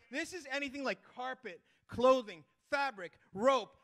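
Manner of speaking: usual speaking voice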